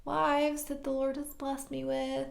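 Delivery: high, soft, slow explanation; mock sheepish. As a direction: draw it out softly, starting high. Jacob sheepishly shows off his family.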